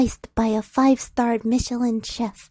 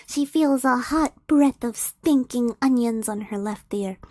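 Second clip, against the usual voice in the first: ordinary delivery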